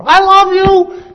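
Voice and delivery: shrieked high and loud, slow; hammed-up screaming husband; mock yelling. Shown as a demonstration. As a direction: scream the love words at her. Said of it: "yell and scream at her".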